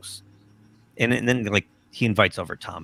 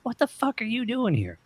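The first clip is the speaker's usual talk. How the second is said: imitated kid, very high and a bit soft; little kid; 3 dB quieter than usual. Kid recognizes rock star at the beach, high mock child voice. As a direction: mimic a small child's high-pitched voice. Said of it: "this little kid comes up to me".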